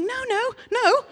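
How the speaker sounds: high, slow, laughing panic; panicked no. Retold or imitated. Preacher reliving hiding from the couple, high panicked laugh. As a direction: squeak out a drawn-out no, no, no. Sees couple follow her.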